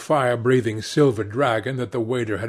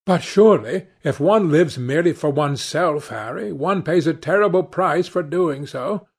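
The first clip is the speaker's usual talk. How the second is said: lightly voiced protest, higher pitched; earnestly objecting painter; 6 semitones higher, 4 dB louder than usual. A narrator's reading. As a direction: gently press the objection with raised pitch.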